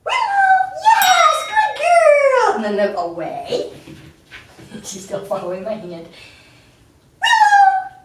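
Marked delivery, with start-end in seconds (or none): 0.1-2.6 very high, loud praise; loud excited praise. Real, not acted. Praising dog after recall. 7.2-7.9 very high, loud praise; loud excited praise. Real, not acted. Calling and praising dog.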